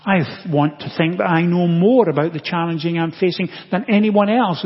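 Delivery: ordinary delivery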